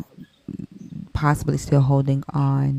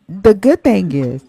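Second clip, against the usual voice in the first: burping; 4 semitones higher, 7 dB louder than usual. Real, not acted. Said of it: "I'm sorry, I'm burping".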